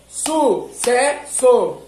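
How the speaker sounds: "Sucesso" drawn out syllable by syllable. Shown as a demonstration. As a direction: tap out "Sucesso" in three slow beats. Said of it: "enquanto você tá falando as sílabas".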